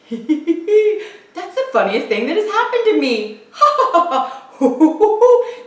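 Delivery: laughing through the words, rising ha and ho; giggling elephant. A narrator's reading. Elephant giggling at the mouse's trick. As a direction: giggle helplessly. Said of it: "He began to giggle".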